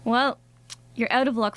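normal talking voice

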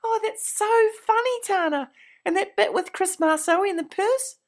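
high, fast gushing praise. Acted. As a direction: fawn over the star guest. Gushing over Tana.

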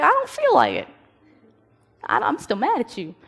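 put-on stubborn kid. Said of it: "I was like".

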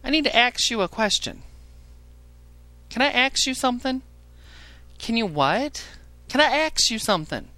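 high, whiny caller voice; high mock caller voice, put on for laughs; mocking a dumb caller. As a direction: mimic a clueless caller begging to ask. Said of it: "These people call in".

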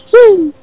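high, loud whoop; excited cheer. Real, not acted. Football fan announcing a cup draw, high cheering whoop. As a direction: whoop it out over the football news.

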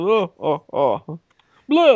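hammed-up Russian accent, monster growl. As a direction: ham up a goofy Russian accent. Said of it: "That's my Russian accent".

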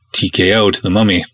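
ordinary delivery

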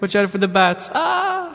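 high laughing yelp with echo; cave echo. Pretend. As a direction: play scared in an echoing cave. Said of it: "I'm in a cave".

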